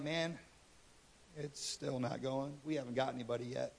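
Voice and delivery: low, very quiet mumbled report; mock sheepish soldiers, hushed and low; reluctant soldiers. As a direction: admit failure softly to your boss, in a low voice. Retold soldiers report failure to king.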